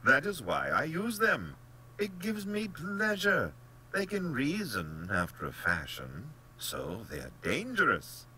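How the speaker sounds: high, loud, relishing hunter; excited, haughty general, slowing for emphasis; gleeful general. Acted. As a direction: explain it with open relish as the general.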